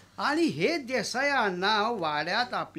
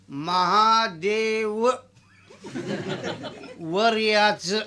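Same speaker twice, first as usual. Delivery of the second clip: put-on slow drunken drawl; much slower than usual. Rama drawls order.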